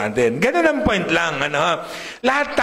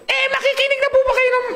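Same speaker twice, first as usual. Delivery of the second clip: much higher, fast mock preaching voice; tiny squeaky preacher; faster than usual. Pastor showing congregation a silly high preaching voice. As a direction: preach like a chipmunk to get a laugh. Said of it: "ang pangit naman kung ang boses ko".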